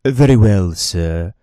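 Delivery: crisp and attentive; courteous bartender. Acted. Bartender taking the bottle order, crisp and formal.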